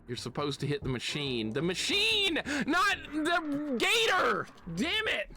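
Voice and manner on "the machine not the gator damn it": very high, frustrated outburst; exasperated yell. Real, not acted. Exasperated at hitting wrong target.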